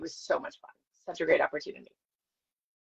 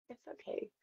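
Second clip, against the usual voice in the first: a soft unvoiced whispered it's okay; 16 dB quieter than usual. Consoling herself after being cut out, whispered. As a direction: whisper it to yourself to cope. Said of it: "I'm like".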